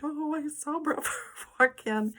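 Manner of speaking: thrilled fan barely holding it in; giddy excitement. Retold or imitated. Seeing Brett Favre walk past the front desk, giddy squeal. Star walks into office.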